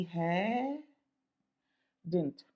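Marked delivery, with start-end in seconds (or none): 2.1-2.4 DIDN'T pronounced dint, low and short; no second d in "didn't"; sound of didn't. Shown as a demonstration. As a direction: say "didn't" as one syllable, dint. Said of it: "sound की spelling".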